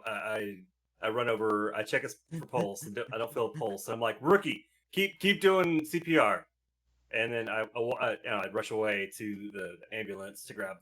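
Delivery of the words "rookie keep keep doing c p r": urgent order. Acted.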